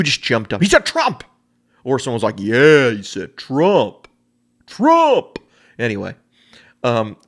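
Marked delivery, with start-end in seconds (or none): 0.6-1.2 high, louder mock outcry; hammed-up offended gasp of a line; imitation of outraged person. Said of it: "my imitation of people who feel two ways". 2.4-5.4 slow, drawn-out mock cheer; hammed-up thrilled supporter; imitation of cheering fan. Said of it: "my imitation of people who feel two ways".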